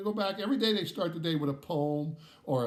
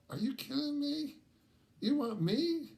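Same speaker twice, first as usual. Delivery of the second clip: high-pitched mock disbelief; incredulous; 12 semitones higher than usual. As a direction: replay your stunned inner reaction, pitched way up. Disbelieving thoughts at dance request.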